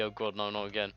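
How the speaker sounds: ordinary talk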